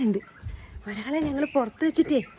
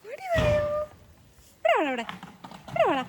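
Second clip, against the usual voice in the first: calling the pet. Real, not acted. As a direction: call the pet over from afar, up high.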